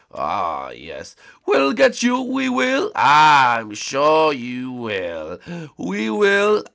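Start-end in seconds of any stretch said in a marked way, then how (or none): 1.5-2.9 put-on high, loud excited kid. Heroes threaten. 2.9-5.4 slow sneering villain; dark lord. Acted. Villain mocks.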